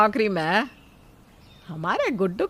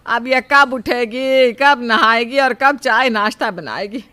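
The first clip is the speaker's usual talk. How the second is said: grumbling; 2 semitones higher, 8 dB louder than usual. Acted. Mother-in-law grumbling about her late-rising daughter-in-law, grumbling exasperatedly. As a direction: grumble with weary, emphatic complaint. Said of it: "Sujata अभी बड़बड़ा ही रही थी".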